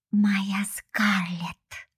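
very slow unvoiced creepy croon; possessive creature whisper. A narrator's reading. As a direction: drag the name out hungrily. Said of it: "уродливые лапы".

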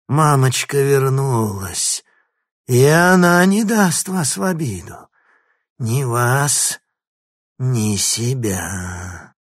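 a gurgling monster mother, slow and menacing; gurgling fish man croak. Acted. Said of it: "хриплый, булькающий голос".

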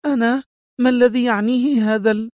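very high, indignant surprise; astonished exclamation. A narrator's reading. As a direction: burst out high in disbelief. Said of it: "هتفت في دهشة".

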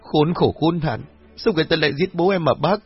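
high-pitched, distraught protest; anguished insistence. A narrator's reading. Daughter insists her father was murdered, anguished.

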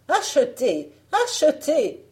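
high, loud street-seller cry; peddler's call. Acted. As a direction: call out your wares to the house. Fausse marchande crie sa marchandise.

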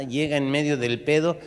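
usual speaking voice

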